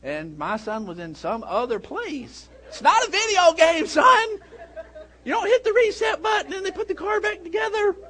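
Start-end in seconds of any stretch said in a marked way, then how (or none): 2.7-7.9 higher and louder, scolding the son; exasperated yell. Retold or imitated. Dad retelling yelling at his son behind the wheel, raised voice. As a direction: bark a warning at a careless teen driver. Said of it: "I was screaming like I have never screamed before".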